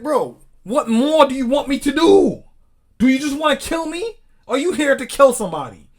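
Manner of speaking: high, loud protest at the officer; exasperated outcry. Acted. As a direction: cry it out in desperate disbelief. Voices suspect pleading with cop.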